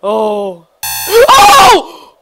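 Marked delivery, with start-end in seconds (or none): 1.3-2.2 high, loud yelp; shrill "oh" of fright; startled scream. Real, not acted. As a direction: cry out in sudden shock. Startled by game.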